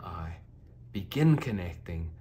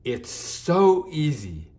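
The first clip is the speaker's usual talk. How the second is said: higher and louder, slowing on so easy; a grateful unicorn thanking the girl; beaming thank-you; overjoyed; 8 semitones higher, 8 dB louder than usual. Acted. Unicorn overjoyed, found her magic.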